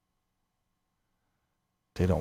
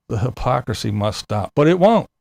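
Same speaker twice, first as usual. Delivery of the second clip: ordinary talk, nothing marked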